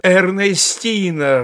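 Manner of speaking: slow, loud, high call; long drawn-out shout up the stairs. A narrator's reading. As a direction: call her name through the whole house. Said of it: "раздалась по всему дому".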